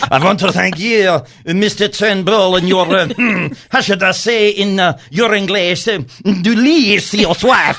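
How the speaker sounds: a hint of Pepe Le Pew searching for the English word; mock French accent. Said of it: "Pepe Le Pew".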